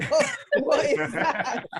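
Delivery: high, slow, laughing disbelief; incredulous question broken up by laughter; mocking laughter. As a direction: imitate listeners laughing her out of the room. Imagined listeners laughing at her accent.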